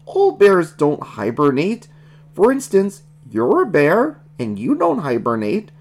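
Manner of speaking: high, swooping frog character voice; reasoning baby Kermit. Acted.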